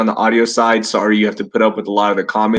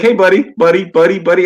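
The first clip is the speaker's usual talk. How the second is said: high, louder doggy pet-talk; mock dog-owner baby talk; 6 semitones higher, 3 dB louder than usual. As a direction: coo at the dog, pitched up and eager. Calling his dog buddy.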